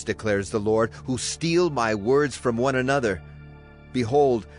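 ordinary delivery

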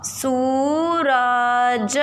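जूता stretched out very slowly; slow reading aloud. Shown as a demonstration. Teacher reading a word while children write, slow. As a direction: draw the word out as pupils write it. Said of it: "फिर लिखा है र".

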